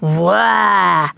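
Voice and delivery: long, drawn-out gorilla grunt; gruff gorilla noise. A narrator's reading. Boy beating his chest at his parents, gruff gorilla call. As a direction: answer like a stubborn little gorilla. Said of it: "Tom said again".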